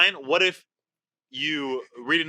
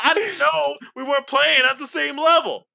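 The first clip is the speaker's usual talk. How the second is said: loud, high mock-indignant protest; sarcastic apology; 11 semitones higher, 6 dB louder than usual. Contestant protesting the game's rules, sarcastic apology. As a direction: apologize with loud, hammed-up outrage.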